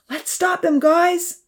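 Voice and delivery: high, eager shout; rally the boat crews; rallying call. Acted. Damien rallying the boat crews, high urgent call.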